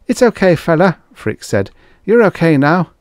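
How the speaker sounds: high, loud reassuring voice; calm the man down; soothing assistant. Acted.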